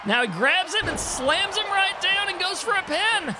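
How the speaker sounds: rising excited play-by-play finish; wrestling commentator. Acted. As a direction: build to the pin like a TV announcer. Calls the match to the finish.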